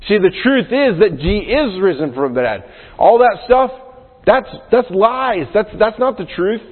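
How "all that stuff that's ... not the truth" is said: emphatic denial. Real, not acted. Preacher rejecting doubts about the resurrection, forceful. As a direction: hammer home that it's lies. Preacher refuting the stolen-body idea.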